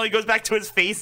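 ordinary talk, nothing marked

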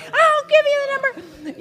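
very high, loud exasperated shriek; frantic re-enacted yell; retold yelling. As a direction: replay snapping at the poor clerk. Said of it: "Then I yell at the girl".

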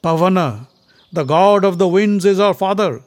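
bold, high boast; proud declaration. A narrator's reading. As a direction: proclaim your lineage forcefully. Bhima boasts of parentage.

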